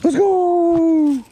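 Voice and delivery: triumphant shout. Real, not acted. Celebrating landing the bass, loud triumphant cheer. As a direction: cheer the catch at the top of your voice.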